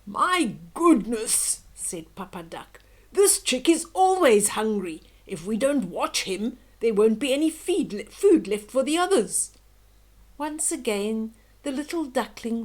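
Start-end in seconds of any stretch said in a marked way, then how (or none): 0.1-1.6 grumbling father. Acted. Papa Duck complains. 3.1-9.5 loud, grumbling father duck; a fed-up father duck grumbling loudly; exasperated father. Acted. Papa Duck complains about chick.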